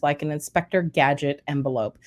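ordinary talk

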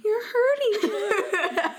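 put-on very high, slow child's voice; little girl voice. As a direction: plead like a small girl, half laughing. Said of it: "the little girl's like".

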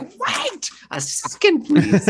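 very high slow pleading; high little pleading voice; mock squeaky plea. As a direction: squeak a desperate delay. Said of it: "And I'm like".

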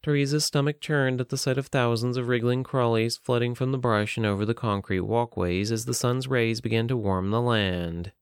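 usual speaking voice

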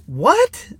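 high, sharp disbelieving "What?"; incredulous what. Retold or imitated. Retelling his reaction to the host's claim, incredulous. Hearing Hitler claim, laughs.

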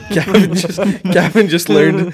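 loud, high-pitched words through laughter; laughing. Real, not acted. Co-host teasing Gavin about his new slang, laughing. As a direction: crack up while getting the words out.

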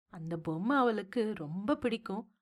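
soft, drawn-out pleading from the wife; wife's quiet, drawling objection; hesitant drawl. A narrator's reading. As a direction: trail it out softly, a tentative suggestion. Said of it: "என்று இழுத்தாள்".

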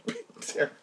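stunned laughing echo. Retold or imitated. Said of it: "I was like".

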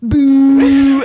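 loud, long jeering boo; crowd boo. Retold or imitated. Pub crowd jeering the guy on his phone, loud boo. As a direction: boo like a whole pub at once. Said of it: "everyone looks and goes".

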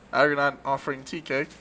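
usual speaking voice

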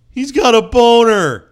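high, drawn-out mocking yell; hammed-up schoolyard holler, slow and very loud; mock taunting shout. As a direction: imitate a kid announcing it to everyone. Acting out kid announcing it publicly.

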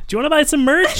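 high loud sales pitch; salesman pitch. Acted. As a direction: hype it like a TV ad.

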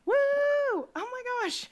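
high, slow, delighted whoop; excited cheer. Real, not acted. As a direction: cheer her big news. Cheering friend's new house.

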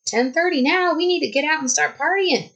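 a hint of rowdy partier. Woman mimicking noisy campers deciding to party, lightly mocking. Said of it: "the other group decided".

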